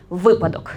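«Випадок» with the stress on ВИ, the first syllable. Shown as a demonstration. Teacher models the correct stress «Випадок» for the audience. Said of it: "єдиний наголос на першому складі".

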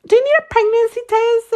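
high, sing-song front desk voice; high mock receptionist asking. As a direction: pitch way up as the receptionist. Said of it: "the girl in the front asked was like".

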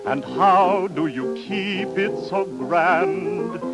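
ordinary talk, nothing marked

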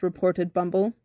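plain reading voice

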